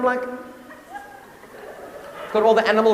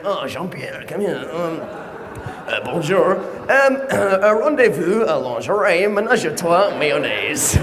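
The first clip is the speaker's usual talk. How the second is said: tourist's bad French; 6 semitones lower, 3 dB quieter than usual. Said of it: "I'll be the guy in the Louvre".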